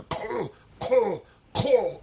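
high-pitched choking sound; imitated choking. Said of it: "and she chokes".

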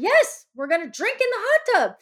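high, emphatic yes; insistent. Retold or imitated. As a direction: overrule his doubt brightly. Insisting to doubtful husband.

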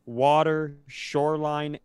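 ordinary talk

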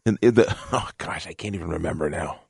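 normal talking voice

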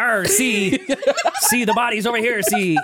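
hammed-up vintage detective patter; forties detective. Mocking the friend's accent as forties detective, hammed-up gumshoe voice. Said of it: "Sound like an old forties, uh, detective show".

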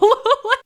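bright burst of laughter; short high laugh. Real, not acted. Laugh after quote.

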